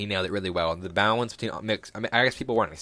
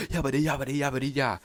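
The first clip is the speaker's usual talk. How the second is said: higher, rapid nonsense chant imitating a rapper; mock rap ad-lib; 6 semitones higher, faster than usual. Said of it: "like, the heavy mindset".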